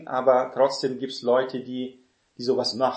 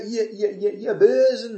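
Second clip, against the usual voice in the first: much higher, ending louder on Bösen, pointing down at sinners; from a high horse; 9 semitones higher, 5 dB louder than usual. Shown as a demonstration. Self-righteous preacher accusing people, haughty and condemning. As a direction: condemn from above, finger pointed. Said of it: "vom hohen Ross herunter".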